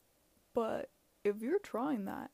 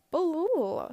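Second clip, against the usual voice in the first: loud, higher-pitched blah-blah filler; mock chatter; 6 semitones higher, 9 dB louder than usual. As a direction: rattle off nonsense talk, raised voice. Filler for imagined casual chat.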